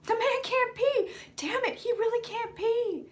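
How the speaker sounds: high, slow mocking voice; squeaky mocking impression; mock Trump voice.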